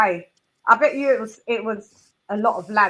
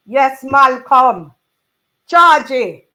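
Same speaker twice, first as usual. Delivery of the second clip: calling out; 3 semitones higher, 10 dB louder, much slower than usual. Real, not acted. Greeting someone by name, calling out.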